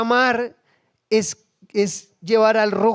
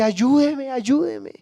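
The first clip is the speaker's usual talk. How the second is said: high-pitched acted begging; desperate pleading; 7 semitones higher, much slower than usual. Preacher voices the kneeling woman begging the prophet, desperate. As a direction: beg urgently, pitch lifted. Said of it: "ella fue clamando".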